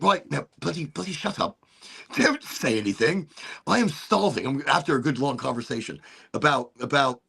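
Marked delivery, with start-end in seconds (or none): 0.0-4.5 hungry British cop. Retold or imitated. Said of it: "he's like".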